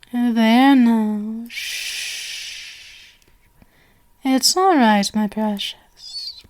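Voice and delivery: hushed and tender; soothing. Acted. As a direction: shush them gently to calm. Soothing drugged guest.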